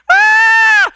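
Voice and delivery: long, high, loud scream; mock childhood scream. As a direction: scream like a punched little brother. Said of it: "then I'd scream".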